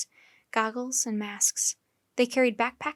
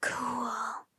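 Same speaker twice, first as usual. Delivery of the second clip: whispered cool; 8 dB quieter than usual. A narrator's reading. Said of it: "whispered Annie".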